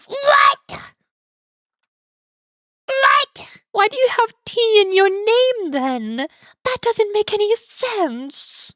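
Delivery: high, drawn-out incredulous protest; mock outraged disbelief. Streamer to a self-named tea enjoyer who dislikes tea, mock outrage.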